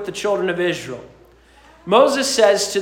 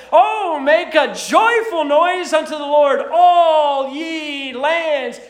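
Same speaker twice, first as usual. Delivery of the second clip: shouted high, then stretched slow; joyful proclaiming; 7 semitones higher, slower than usual. Acted. Pagan nations breaking into praise, joyful shout. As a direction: call out the praise like a jubilant crowd.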